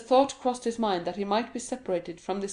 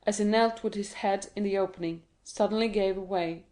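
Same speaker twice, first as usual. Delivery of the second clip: plain reading voice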